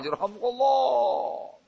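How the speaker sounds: high, long, softened echo of a crowd; menirukan seruan menggema. Retold or imitated. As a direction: imitate a distant crowd's drawn-out chant. (menirukan gema seruan yang panjang dan pelan)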